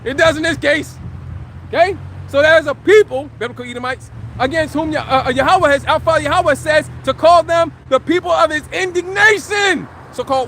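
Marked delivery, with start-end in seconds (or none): none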